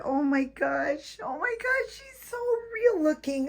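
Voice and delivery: high thrilled gush; delighted squeal. Real, not acted. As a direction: squeal like you can't believe how real she looks. Gushing over the doll's realism.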